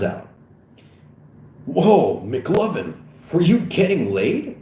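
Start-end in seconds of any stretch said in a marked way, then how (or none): 1.7-4.6 high, loud astonishment; incredulous raised-voice question; amazed officer. Acted. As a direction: ask in delighted disbelief.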